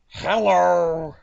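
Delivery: loud, drawn-out goofy hello; silly greeting voice. Acted. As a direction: blare out one long silly hello.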